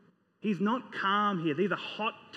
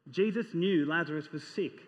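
normal talking voice

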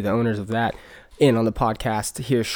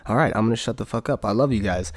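ordinary delivery

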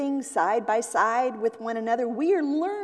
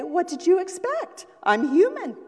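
higher, louder grandma owning her success; proud grandma; 8 semitones higher, 4 dB louder than usual. Retold or imitated. Grandma answering praise for her cake, higher and louder. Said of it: "why don't you just say".

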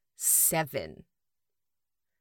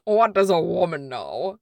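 dramatic movie quote; 6 dB louder, slower than usual. Retold or imitated. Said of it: "how they did that dichotomy of".

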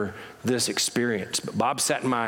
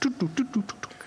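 high toot toot of a dialing phone; phone dial tones; 11 semitones higher than usual. Retold or imitated.

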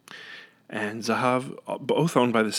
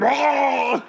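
high loud startled cry; mock shock; 14 semitones higher, 5 dB louder than usual. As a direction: gasp like a kid facing the fizz. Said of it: "I'd be like".

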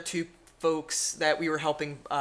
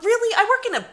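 exasperated disbelief; 6 dB louder, faster than usual. Retold or imitated. Venting about strange customer.

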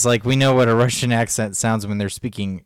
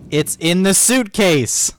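higher, louder, drawn-out insistence; exasperated insistence; 10 semitones higher, 5 dB louder, slower than usual. Real, not acted. Insisting to partner about the missing shirt, real exasperation. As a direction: slowly hammer it home, exasperated.

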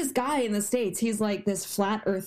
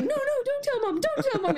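much higher panicked begging; pleading kid; 13 semitones higher than usual. Retold or imitated. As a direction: beg like a scared sibling. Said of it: "They're like".

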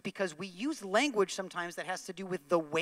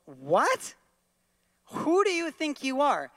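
higher, indignant; offended shopper; 7 semitones higher, 4 dB louder, slower than usual. Pretend. Shopper snaps back at the preacher, indignant. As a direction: bristle at being told off. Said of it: "That guy is gonna look at me and go".

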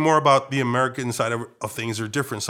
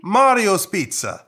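loud, high, drawn-out mock Italian; bad Italian accent; 9 semitones higher, 7 dB louder, slower than usual. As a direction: ham up a slow, singing Italian accent. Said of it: "busting out my bad Italian accent".

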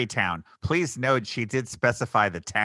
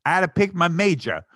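loud, high, hammed-up New York Italian accent; over-the-top New York accent; 6 semitones higher, 6 dB louder than usual. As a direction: ham up a Brooklyn wiseguy. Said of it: "her accent in this was so ludicrously over the top".

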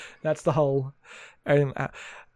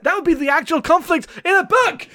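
higher, laughing near-shout; laughing exasperation; 10 semitones higher, 4 dB louder than usual. Real, not acted. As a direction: laugh it out in disbelief. Exasperated complaint about the book.

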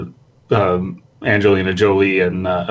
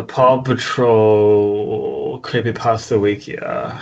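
slow creepy read; much slower than usual. Acted. Friend takes over creepy reading.

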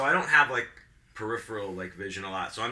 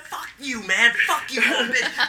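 high-pitched mock raging, growing louder; retold angry outburst; 12 semitones higher, 7 dB louder than usual. Performer held back from the old heckler, retold raging. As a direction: re-enact cursing someone out while being held back. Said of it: "They're holding me back".

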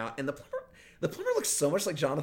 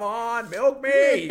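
cow voice; 10 semitones higher, 9 dB louder, much slower than usual. Retold or imitated.

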